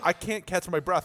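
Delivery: normal talking voice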